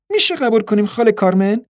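put-on high, girlish pleading. A narrator's reading. Girl asks her aunt to accept the tickets, pleading. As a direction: beg sweetly in a high young voice. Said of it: "دختر با لحنی ملتمسانه پرسید".

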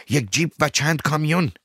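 high, loud alarmed shout. Acted. Yusuf spotting a jeep and trucks, shouting the alarm. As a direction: yell the warning out. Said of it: "یوسف فریاد زد".

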